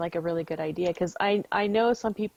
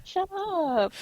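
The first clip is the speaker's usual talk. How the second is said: laughing; 12 semitones higher than usual. Real, not acted.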